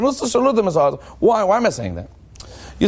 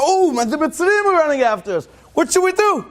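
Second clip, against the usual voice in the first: higher-pitched cry of alarm; mock panic; 8 semitones higher than usual. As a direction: act out a crowd in sudden panic. Retold panicked cry of Israelites.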